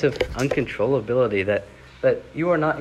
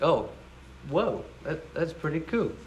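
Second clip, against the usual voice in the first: breezy, offhand amazement, softer; casual whoa; 4 semitones higher, 6 dB quieter than usual. Retold or imitated. Preacher mocking the casual "whoa" for contrast, breezy and soft. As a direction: shrug it off like a casual teen. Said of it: "it's not like".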